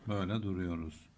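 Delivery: usual speaking voice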